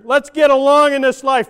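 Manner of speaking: loud, pleading call to unity; pleading shout. Acted. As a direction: shout it as a plea for peace.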